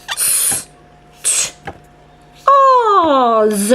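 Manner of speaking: whispered s, then t, then oz; segmenting sounds. Shown as a demonstration. Teacher sounds out stars for the child, s whispered. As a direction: break stars into separate sounds. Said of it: "listen for the sounds".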